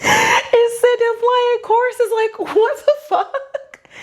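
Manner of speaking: slow, high squeal through laughter; real helpless giggles; crying with laughter. Real, not acted. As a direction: barely get it out for laughing. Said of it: "I'm crying".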